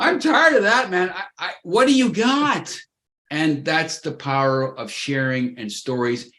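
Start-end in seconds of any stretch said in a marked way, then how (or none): 0.0-2.7 high, loud comic frustration; mock exasperated outburst. Host venting about the ninety-year-old, loud high mock outrage. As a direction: throw up your hands, hammed-up fed up.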